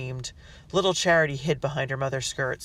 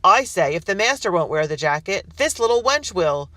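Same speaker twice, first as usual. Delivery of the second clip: gloating threat; 5 semitones higher, 8 dB louder than usual. Acted. Rebel threatening the girl.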